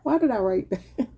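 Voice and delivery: baffled question through real laughter; giggly self-mocking question; laughing. Real, not acted. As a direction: crack up at your own writing. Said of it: "I'm sorry for laughing".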